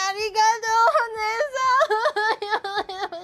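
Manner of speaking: high, loud, tearful imitation of the child's thanks; imitating the little girl. Quoting the girl who thanked her, teary. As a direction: echo her tiny thank-you through tears. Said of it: "she said".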